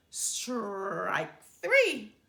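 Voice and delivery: loud, bellowed strike call; bawling umpire. Acted. Umpire calling the final strike on Brother, bellowing. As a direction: bawl it out like an umpire. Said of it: "bawled the ump".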